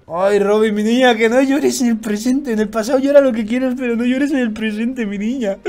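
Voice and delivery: put-on high, whiny cooing to a child; pitying plea. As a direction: wail fondly as if consoling a little girl. Pleads with crying Robin not to cry.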